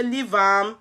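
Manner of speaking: lightly voiced mum saying ignore her; a hint of a weary mother telling them to leave the child; dismissive mother. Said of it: "my mama say".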